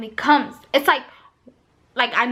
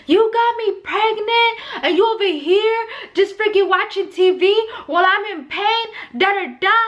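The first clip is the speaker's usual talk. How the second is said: high-pitched ranting re-enactment; worked-up mock tirade, pitched up; retold cussing out; 8 semitones higher, 3 dB louder than usual. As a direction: act out yelling at your husband. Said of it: "I yelled at him and I cussed him out".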